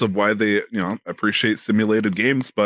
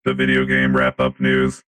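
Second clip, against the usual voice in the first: deep, monotone robot voice; robot faking human; 9 semitones lower than usual. Acted. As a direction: speak like a stiff robot pretending to be human. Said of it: "Nickotron three thousand robot".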